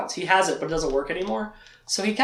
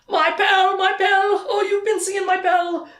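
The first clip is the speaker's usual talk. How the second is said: much higher, louder joyful exclaiming; overjoyed cry; 3 dB louder, slower than usual. Retold or imitated. Blind Addie hearing of her Pell, overjoyed high cry. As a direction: burst out in joyful disbelief. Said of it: "And she's like".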